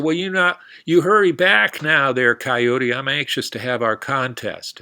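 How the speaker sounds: cowboy shouting after Coyote, high and loud; cowboy calling out. Acted. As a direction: call out after him as he rides off.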